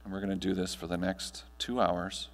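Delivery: guided meditation. Acted. Speaker guiding the audience through breathing, calm meditation voice. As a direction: ease the room gently into stillness.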